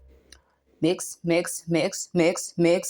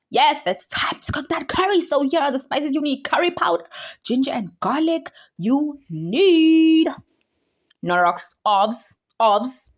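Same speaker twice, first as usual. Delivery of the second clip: high, louder excited listing; excited presenter; 8 semitones higher, 4 dB louder, slower than usual. Real, not acted. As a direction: announce the spices with glee.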